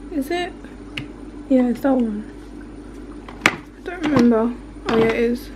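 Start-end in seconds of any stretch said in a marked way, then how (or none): none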